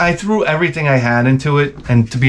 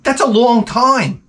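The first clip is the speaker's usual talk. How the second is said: mock surprise; 9 semitones higher than usual. Talking to people at the park, high mock wonder. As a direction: marvel in a put-on voice. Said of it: "I was like".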